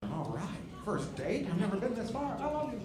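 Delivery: lightly voiced date, pitch rising to the end; acted first-date reply. Comic acting out a couple's first date, hesitant and rising.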